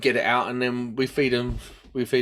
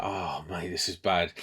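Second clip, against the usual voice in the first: quiet dismay; 7 semitones lower, 7 dB quieter than usual. Retold or imitated. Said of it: "I was like".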